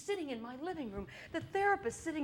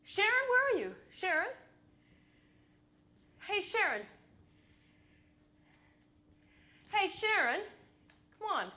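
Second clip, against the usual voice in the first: high, louder calling out for a vanished friend; plaintive calling out for Sharon, higher and louder; 8 semitones higher, 4 dB louder than usual. Retold or imitated. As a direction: call her name as if searching, higher.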